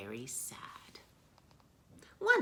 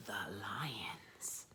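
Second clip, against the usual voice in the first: low dramatic whisper; reveal whisper; 6 semitones lower, 8 dB quieter than usual. A narrator's reading.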